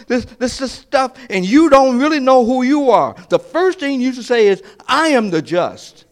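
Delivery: preaching at full force. Acted. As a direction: preach it, climbing to a shout. Preacher acting a challenging questioner.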